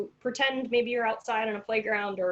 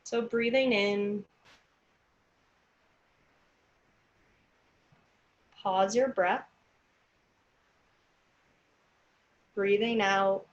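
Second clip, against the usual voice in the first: slow, calm counting of breaths; guided breathing; slower than usual. Acted. Leads counted breathing exercise.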